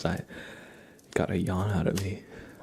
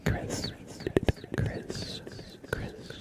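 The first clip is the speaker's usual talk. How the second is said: ASMR whisper; slower than usual. Acted. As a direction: breathe the words close to the mic. ASMR name trace whispered.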